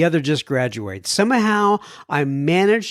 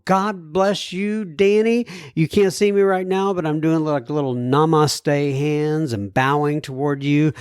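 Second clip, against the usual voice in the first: ordinary delivery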